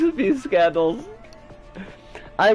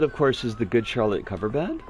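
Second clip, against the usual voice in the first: usual speaking voice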